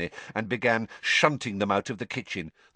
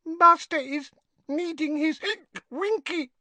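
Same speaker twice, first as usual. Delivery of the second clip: high, whimpering house elf. A narrator's reading. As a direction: whine it like a forlorn elf. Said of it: "whimpered the elf".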